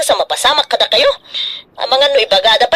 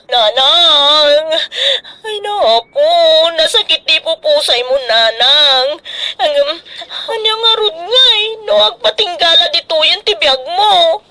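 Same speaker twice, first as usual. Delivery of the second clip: grieving; 4 semitones higher than usual. Acted. Grieving over dead mother.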